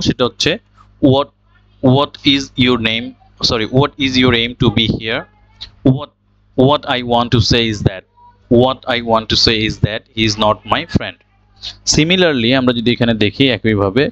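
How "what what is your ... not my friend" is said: "what" said "wot", no h, slowly; slow model sentences, each "what" starting on plain w; correct pronunciation. Shown as a demonstration. Teacher models the correct way for learners, "what" as "wot". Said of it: "তাহলে আমরা এখন কারেক্টটা শিখবো".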